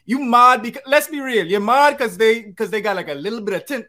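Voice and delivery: heated retort. Retold or imitated. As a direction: push back hard at the xenophobes. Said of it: "I'm more like".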